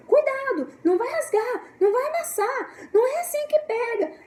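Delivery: put-on high, anxious nagging over a child's book; a hammed-up high-pitched mother scolding her child about the book; fussy scolding parent. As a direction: fret at the kid like a worried parent. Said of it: "a gente já fala".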